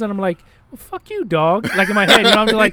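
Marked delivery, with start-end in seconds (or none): 0.7-1.7 high, squeezed-out comeback to a dog; mock indignant retort. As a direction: start high, drop on the last word. Acted retort to the judging dog.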